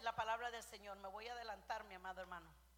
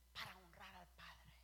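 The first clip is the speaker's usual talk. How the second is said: slow, soft whisper on honrar al Padre; whispered reverence; 5 semitones lower, 8 dB quieter than usual. Acted. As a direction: hush the words para honrar al Padre. Repeats phrase, then whispers it.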